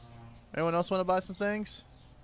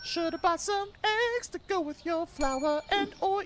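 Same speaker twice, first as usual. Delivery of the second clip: much higher sing-song taunt; mocking taunt; 13 semitones higher than usual. Shopkeeper taunting a customer who forgot eggs, high sing-song mockery. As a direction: tease the shopper in a high mocking lilt.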